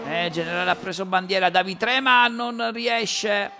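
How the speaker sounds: fast race commentary, rising with the action; live play-by-play. Real, not acted. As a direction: call the qualifying lap live.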